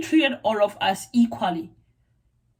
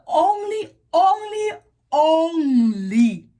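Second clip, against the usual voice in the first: "only" hit hard three times, pitched high then dropping; emphatic repetition; 6 semitones higher, 4 dB louder, much slower than usual. Real, not acted. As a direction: hammer each "only" separately, loud and drawn out. Insisting on the one teacher.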